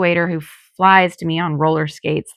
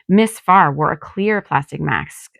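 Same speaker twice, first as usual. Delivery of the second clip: usual speaking voice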